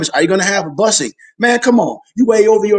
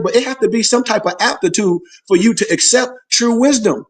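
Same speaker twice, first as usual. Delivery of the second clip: ordinary talk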